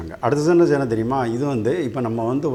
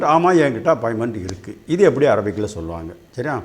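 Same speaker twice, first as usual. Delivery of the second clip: normal talking voice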